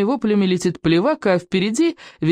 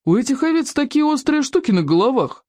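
high, puzzled dimwit; dim boy voice; 8 semitones higher than usual. A narrator's reading. Boy Bestolkov informs the group in a higher puzzled voice. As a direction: sound earnestly clueless about the horns. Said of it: "сообщил Бестолков".

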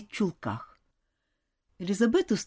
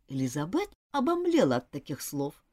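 usual speaking voice

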